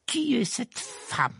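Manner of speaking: high, slow, sneering question; slow, disgusted uncle. A narrator's reading. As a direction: ask it as if the name tastes bad. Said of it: "d'un air dégoûté".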